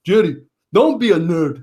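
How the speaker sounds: mock the therapist's Korean accent. Physical therapist teasing Jerry, in an imitated Korean accent. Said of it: "in his Korean accent".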